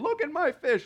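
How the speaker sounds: high, gloating show-off cry; mock boast; bragging fisherman. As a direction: crow about the catch like a proud angler.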